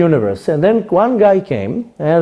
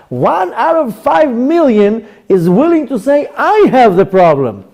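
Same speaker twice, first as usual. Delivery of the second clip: higher, slower, wide-eyed wow; amazed exclamation; 6 semitones higher, slower than usual. Retold or imitated. Rabbi telling the congregant he admires him, amazed. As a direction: marvel at the rare man who owns it. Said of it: "I told him".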